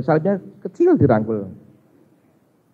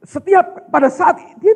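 ordinary delivery

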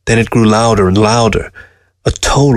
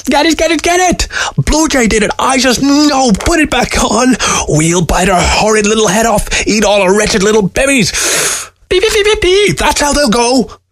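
high, shrieking, vengeful weasel voice; frantic weasel; 13 semitones higher than usual. A narrator's reading. Weasel raging at Blue Jay over a stolen eye, high and frantic screech. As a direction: screech it like a furious little creature.

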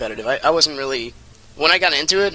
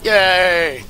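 cheer; 5 semitones higher, 5 dB louder than usual. Real, not acted. Cheering in the game.

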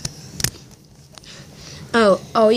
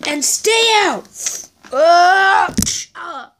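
yell and pained cry; 8 semitones higher, much slower than usual. Acted. Thrown out and hurt.

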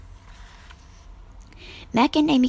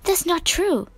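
protesting; 7 semitones higher than usual. A narrator's reading.

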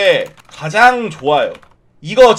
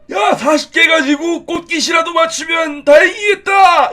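put-on high, shouting old man voice; grumpy old man; 9 semitones higher than usual. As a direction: yell like a cranky grandpa. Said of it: "이런 느낌의 톤으로". (소리치는 할아버지 톤)

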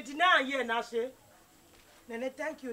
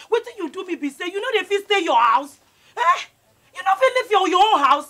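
loud, higher, demanding yell; angry shout; 6 semitones higher, 6 dB louder than usual. Acted. Lucy yelling at Nene during the quarrel. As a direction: shout the accusation at her.

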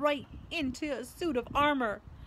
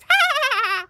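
loud, high drawn-out mocking laugh; imp's cackle; 13 dB louder than usual. A narrator's reading. Peeves whooshing away from Filch, high wild cackle. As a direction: let out a victorious cackle.

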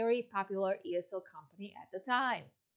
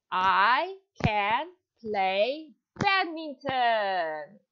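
"I can play badminton" said slowly, word by word; slow model sentence; 4 dB louder, much slower than usual. Shown as a demonstration. Teacher to John, slow model sentence. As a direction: drill each word slowly for the child. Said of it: "Can you say".

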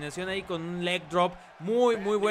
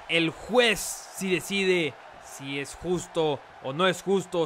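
ordinary talk

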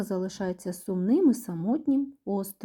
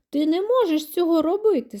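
high, loud indignant complaint; complaining; 9 semitones higher, 5 dB louder than usual. A narrator's reading. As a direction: protest like a wronged child, voice raised high. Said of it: "скаржиться Діді". (скарга високим, голосним голосом)

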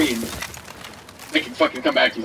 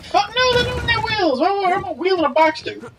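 incredulous. Real, not acted. Incredulous rant about box wheels.

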